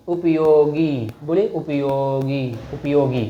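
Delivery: उपयोगी said slowly with the final गी drawn out; slow उपयोगी, hold the long ई at the end; teacher drags out उपयोगी to show the long vowel; stretched long ई. Shown as a demonstration. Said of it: "ग पे थोड़ा sound ज़्यादा रहता है".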